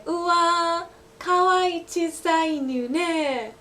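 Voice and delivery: high, slow cooing over a puppy; gushing over a cute dog. Shown as a demonstration. Teacher showing standard Japanese, cooing over a cute dog. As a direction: coo it like you just saw a puppy. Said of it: "you can say".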